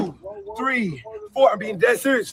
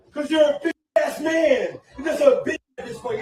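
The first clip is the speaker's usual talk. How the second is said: laughing mockery; 2 semitones higher than usual. Real, not acted. Altercation, laughing insults.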